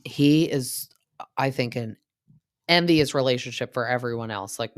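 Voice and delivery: ordinary talk, nothing marked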